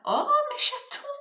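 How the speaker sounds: high, loud burst of delight; delighted squeal. Real, not acted. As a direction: squeal with joy at the cards. Delighted by cards drawn.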